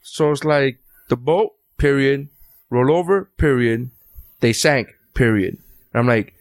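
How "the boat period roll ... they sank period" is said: "period" said as its own word after each short phrase, with breaks; staccato line reading. Retold or imitated. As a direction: read each fragment separately, then say "period". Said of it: "the lines come with every word and then a period".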